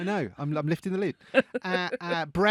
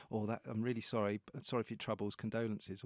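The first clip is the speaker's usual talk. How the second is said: soft mock condolence; 7 semitones lower, 11 dB quieter than usual. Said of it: "pin it in the".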